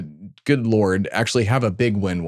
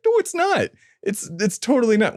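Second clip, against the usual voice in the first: much higher, squeaky mock protest; high indignant retort; 11 semitones higher than usual. Host retorts to Disney's copyright claim in a high squeak. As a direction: shoot it back in a high, put-on squeak. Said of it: "I'm like".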